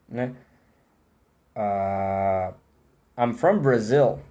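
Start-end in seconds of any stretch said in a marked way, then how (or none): 3.2-4.2 slowish I'm from Brazil. with a clear English R; English answer demo. Shown as a demonstration. Teacher answering his own question, slowish English demo. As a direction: model the reply I'm from Brazil. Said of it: "Tem um R também".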